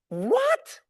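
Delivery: very high, loud disbelieving squeak; incredulous "What?". Retold or imitated. Son reacting to mom's advice, a high disbelieving squeak. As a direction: sound like the idea is absurd. Mom says be kind to Ali.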